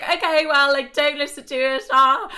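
high, loud mock panic; flustered panic. Retells panicked reply on phone.

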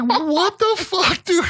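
very high, drawn-out squeal; hammed-up panicked dad; shocked disbelief. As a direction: stretch it out in squeaky incredulity. Dad reacts to son's words.

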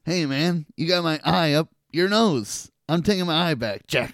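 high, cocky little fly voice; lightly voiced cheeky bug; mock fly voice. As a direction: voice the fly grabbing his eye back. Fly character voiced in recap.

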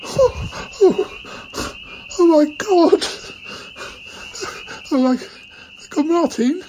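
high, gasping disbelief; stunned, halting, high-pitched reaction; overcome with emotion. Acted. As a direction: reel at astonishing news, voice leaping up. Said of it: "We're all a bit emotional now".

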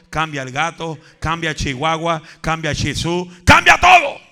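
steady build from firm to full shout. Acted. Building preaching list.

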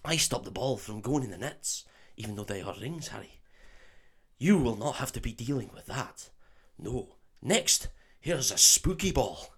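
soft put-on Scottish accent; Oliver Wood. Quidditch keeper teaching Harry, hushed Scottish accent. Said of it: "for me to do a Scottish accent".

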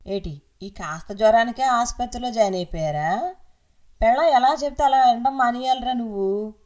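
a busybody aunt handing out unwanted advice; aunt lecturing her nephew in the hospital, loud and nagging; scolding aunt. Acted.

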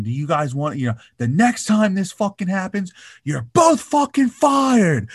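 building from normal to a high, loud furious yell; mock Tom Cruise snapping at crew; imitating an angry rant. Impersonating Tom Cruise yelling at crew members. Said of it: "He's fucking screaming at him".

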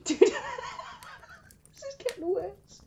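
slow words broken up by laughter; laughing. Real, not acted. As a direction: try to speak through a giggle. Laughing fit.

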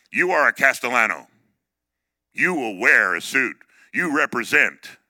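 loud, emphatic decree from dad; stern father. Retold or imitated. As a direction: act out a father laying down the law. Father lays down law in retelling.

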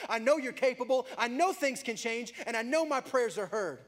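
loud, high impassioned prayer; preaching prayer. Acted. Preacher voicing a bold prayer to God, impassioned. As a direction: cry out to God at full force.

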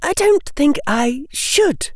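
put-on high girlish voice for Hermione; young girl's voice. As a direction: play the bright, bold schoolgirl.

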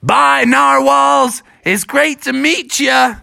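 high, drawn-out goodbye call; Bubby's farewell. A narrator's reading. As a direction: call a slow farewell across the water. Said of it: "And Bubby said".

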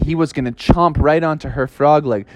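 usual speaking voice

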